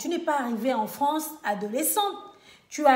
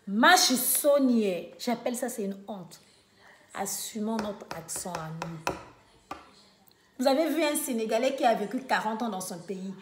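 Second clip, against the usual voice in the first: usual speaking voice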